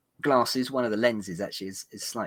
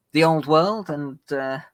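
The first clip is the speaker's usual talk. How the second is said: ordinary talk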